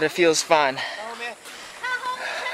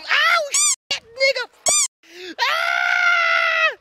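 high pained shout of surprise; startled yelp; much slower than usual. Real, not acted. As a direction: yelp at a sudden jolt. Sudden jolt on bridge.

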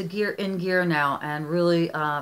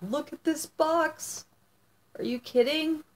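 amazed; 10 semitones higher than usual. Real, not acted. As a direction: marvel at the box as you open it. Opening a gift box, amazed.

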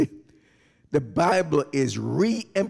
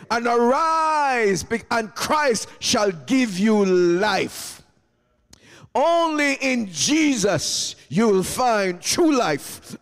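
impassioned preaching; 5 semitones higher than usual. Real, not acted. Preacher calling sleeping souls to wake, impassioned preaching. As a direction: call it out high, stretching key words.

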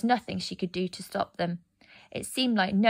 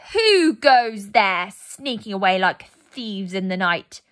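slow, loud, cold queen's challenge; menacing hiss; 7 dB louder, slower than usual. A narrator's reading. Snow Queen catching the fleeing children, slow cold menace. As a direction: draw it out like ice creeping down a hall. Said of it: "it hissed".

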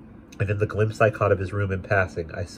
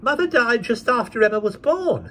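put-on young girl; 4 dB louder than usual. A narrator's reading. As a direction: explain earnestly like a child. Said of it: "said the child".

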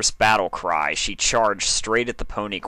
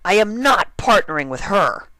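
angry; 13 semitones higher, 7 dB louder than usual. Acted. Dash refusing angrily.

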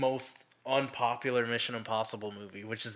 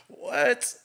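surprised; 11 semitones higher, 4 dB louder than usual. Retold or imitated. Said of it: "I was like".

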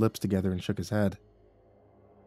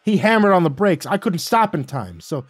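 loud, high, agitated account; heated retelling; 7 semitones higher, 8 dB louder than usual. Acted. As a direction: spit it out, still worked up. Wally angrily recounting the chase.

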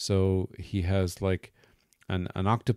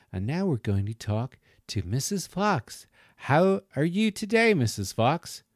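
sing-song American presenter, swooping higher; American group leader; 7 semitones higher than usual. Retold or imitated. Group leader greeting a puppet for the children, American sing-song. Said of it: "And she was American".

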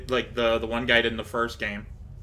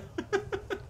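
high giggle; 10 dB quieter than usual. Real, not acted. Laugh after calling out.

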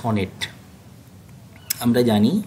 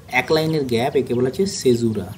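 normal talking voice

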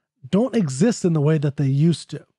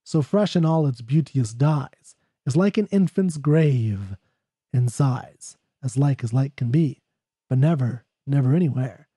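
measured, lower poetry reading; poem reading; 3 semitones lower than usual. Acted. Reads poem aloud.

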